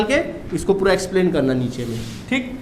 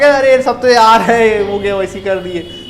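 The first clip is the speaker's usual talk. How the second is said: mock careless student; 2 semitones higher, 7 dB louder than usual. Teacher voices a careless student.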